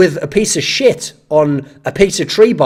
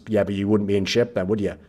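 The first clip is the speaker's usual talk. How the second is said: low reasonable reply; 7 semitones lower, 7 dB quieter than usual. Pretend. Calm voice of reason.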